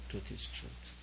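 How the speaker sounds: "truth is truth" breathed as a soft whisper. Shown as a demonstration. Guru whispering truth to the retreat crowd. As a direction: drop to a hushed whisper to the listeners. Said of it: "Whether said sweetly or whether said harshly".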